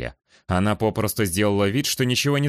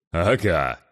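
bold giant; 3 semitones lower than usual. Acted. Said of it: "Смело улыбнулся гигант".

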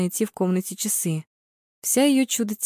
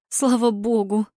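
exhaled relief; 3 semitones higher, slower than usual. A narrator's reading. Mara relieved at the score, exhaling. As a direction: sigh of relief. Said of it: "Шумно выдохнула Мара".

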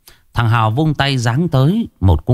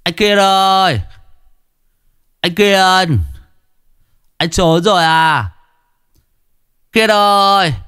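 much higher, harsh yells for Kiên; harsh taunting yells; harsh calling; 10 semitones higher than usual. Acted. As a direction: yell at the door, harshly. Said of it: "Giọng nó gắt gỏng".

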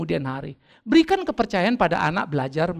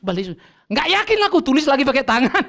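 usual speaking voice

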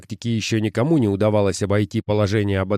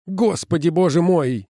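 dismayed cry; 8 semitones higher than usual. Acted. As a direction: wail in dismay. Sims groans in dismay.

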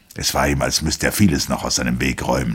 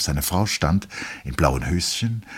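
usual speaking voice

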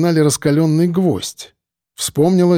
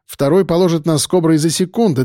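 plain reading voice

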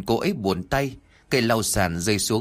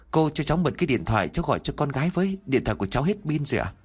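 rushed, higher pleading; hurried plea; 5 semitones higher, much faster than usual. Acted. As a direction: beg quickly, anxious. Begging the cleaner for her phone. (van nài gấp gáp, giọng cao hơn)

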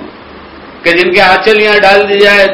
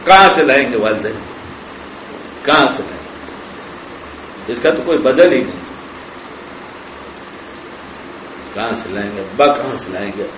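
sorrowful lament; 9 semitones lower, 8 dB quieter than usual. Real, not acted. Preacher mourns to the congregation that lost parents cannot be replaced, sad and fading. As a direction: lower and much softer, fading to near silence.

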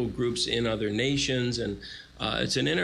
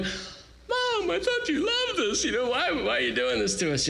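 put-on much higher, whiny child's plea to mom; whining kid; 11 semitones higher than usual. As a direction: betteln wie ein gekränktes Kind. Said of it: "I'm like".

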